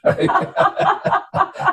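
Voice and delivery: high breathy burst of laughter. Real, not acted. Man laughing at his own excuse to the Lord, high and breathy. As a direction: laugh at the joke on himself.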